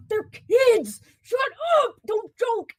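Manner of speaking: high, loud telling-off; retold scolding shout. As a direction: yell at them to stop. Scolding brother in retold scene.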